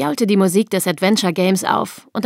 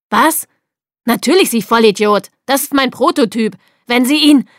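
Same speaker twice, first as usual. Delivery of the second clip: louder, higher indignant protest; angry outburst; 4 semitones higher, 5 dB louder than usual. A narrator's reading. Ed berates technician over his prototype, angry outburst. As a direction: snap furiously at the technician.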